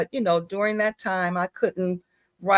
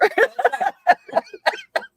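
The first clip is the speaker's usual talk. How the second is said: high laugh. Real, not acted. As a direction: burst of high giggling. Laughs at own joke.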